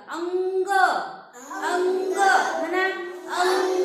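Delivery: loud, slow "अंग" with a clear ng sound; teacher's model reading. Shown as a demonstration. Teacher models "अंग" loudly for the class. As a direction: say "अंग" slowly and loudly, with ng before g. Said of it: "त्याचा उच्चार असा करायचा बघा".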